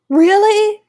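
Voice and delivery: high, loud, gasping surprise; surprised gasp. Real, not acted. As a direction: gasp and ask in disbelief. Gasps at the news.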